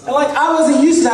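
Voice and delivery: normal talking voice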